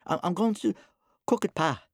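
put-on high, hesitant child's voice; timid small son, high-pitched; little boy. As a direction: stammer an answer to Pa. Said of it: "said the little boy".

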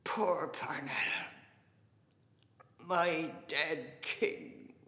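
bitter sobbing lament; slow, broken cry of grief with long pauses; Mister Casey. A narrator's reading. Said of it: "he sobbed loudly and bitterly".

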